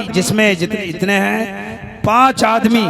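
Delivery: ordinary delivery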